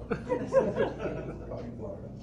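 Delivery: ordinary delivery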